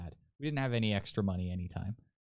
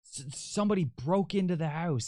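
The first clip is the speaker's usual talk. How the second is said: frantic mom; 8 semitones higher than usual. Retold or imitated. Mother telling son about the break-in, high panicked stammer. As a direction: blurt it out like a scared mom. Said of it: "she's freaking out".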